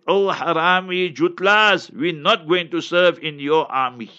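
ordinary delivery

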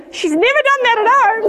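high, loud outraged exclamation; mock indignation. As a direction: protest loudly in comic disbelief. Mother's indignant retort about weeding.

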